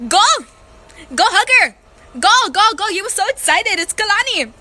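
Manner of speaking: high, loud mock cheering; hammed-up, high-pitched urging; gleeful egging-on. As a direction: push someone forward with excited shouts. Egging cousin on toward old lady.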